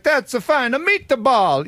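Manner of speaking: quick hammed-up Italian impersonation; Italian accent. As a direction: toss off a caricature Italian accent. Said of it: "If it was Italian accent".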